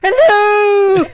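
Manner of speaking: loud, very high falsetto greeting; Mrs. Doubtfire. Retold or imitated. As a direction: belt out a shrieky mock hello. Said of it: "sound like Miss Doubtfire".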